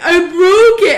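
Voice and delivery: loud, high mock wail; mock crying. Sister imitating her brother confessing to her, mock crying. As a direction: blurt it out as a hammed-up bawl. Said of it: "and he's crying".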